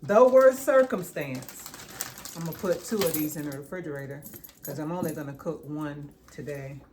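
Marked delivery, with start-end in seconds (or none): 0.0-2.3 slow, louder and higher on worst circumstance; dragging out the worst circumstance to drive it home; emphatic stress. Real, not acted. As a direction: lean hard on the worst circumstance, drawn out. Stressing how bad it looks.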